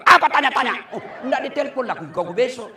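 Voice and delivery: loud, fast, laughing scolding; a wife telling off her husband, hammed up; mock fierce retort. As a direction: bite back at him, half joking. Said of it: "Coba kalau aku tegas".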